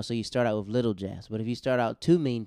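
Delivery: ordinary talk, nothing marked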